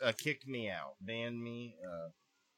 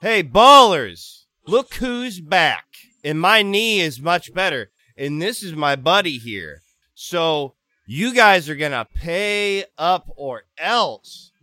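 loud, high shout, drawn out; calling out; 6 semitones higher, 12 dB louder, slower than usual. Acted. Player hailing old Street Ballers gang, loud boastful call-out. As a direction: swagger back in and holler at the crew. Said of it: "I say".

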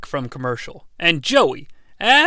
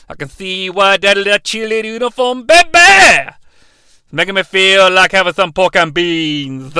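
leering oddball character; 4 dB louder than usual. Acted. Oddball ogles a cheerleader uniform, hammed-up leering.

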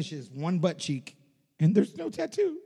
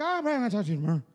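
put-on higher-pitched, drunken Irish accent; drunk Irish impression; 5 semitones higher, much faster than usual. Imitating the always drunk, breathless pub manager, Irish accent. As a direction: run the words together in tipsy Irish. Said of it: "my Irish accent is basically him".

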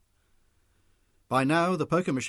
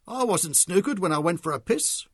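loud, higher complaint; complaining; 6 semitones higher, 6 dB louder than usual. A narrator's reading. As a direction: protest the unfair snooker.

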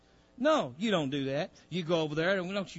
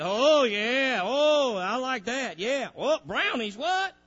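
craving body; 7 semitones higher, 5 dB louder, slower than usual. Acted. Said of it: "Your body loves that".

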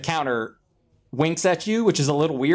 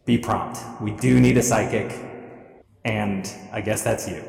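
measured, slightly low telepathic instruction; inner voice; 3 semitones lower than usual. Acted. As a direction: spell it out evenly, mind to mind. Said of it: "And inside your head, again, you hear".

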